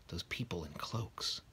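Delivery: slow uneasy whisper. A narrator's reading. Mr Dursley broods over the cloaked strangers, whispering. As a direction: let the worry hang in a whisper.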